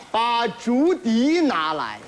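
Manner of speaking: slow pompous order; slow, grand. Acted. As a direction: command it slowly and grandly.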